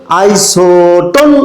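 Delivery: আইসোটোন drawn out slowly with a push on the final ন; Arabic qalqala style. Shown as a demonstration. Teacher demonstrating আইসোটোন chanted with a push on ন. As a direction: stretch আইসোটোন and bounce the last ন. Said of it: "নতে একটা ধাক্কা".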